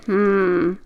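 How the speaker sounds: plain reading voice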